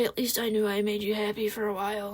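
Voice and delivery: soft, fast, mock-tragic sigh of a line; melodramatic goodbye. Ex's mock-tragic parting line, soft and fast. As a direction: play the martyr softly.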